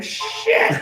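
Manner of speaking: sudden very high-pitched squeal; mock panicked yelp. Rider looking straight down the coaster drop, mock panicked yelp.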